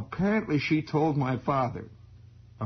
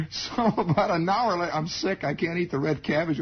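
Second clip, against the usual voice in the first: ordinary talk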